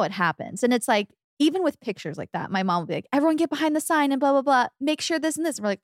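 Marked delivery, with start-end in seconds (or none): 3.1-5.6 higher, quick bossy mom voice; mock fussy mom giving orders, raised pitch. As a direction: imitate mom herding everyone for a photo. Imitates mom marshalling family photos.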